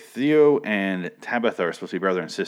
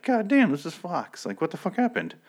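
squeaky high-pitched shock, mock disbelief; imitating a stunned viewer, pitched way up; 11 semitones higher than usual. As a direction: gasp it out way up high like you can't believe what's on TV. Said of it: "you're like".

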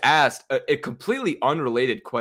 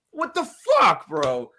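much higher, louder what the fuck; real exasperated outburst; 5 semitones higher, 3 dB louder than usual. Real, not acted. Bettor reliving a missed kick, angry. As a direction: blurt it out, fed up all over again. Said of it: "I'm getting all angry again".

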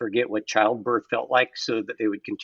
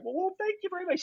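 mock gracious; 7 dB quieter than usual. Retold reply to client.

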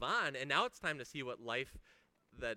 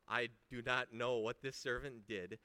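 usual speaking voice

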